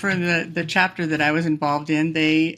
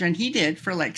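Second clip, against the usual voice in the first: ordinary delivery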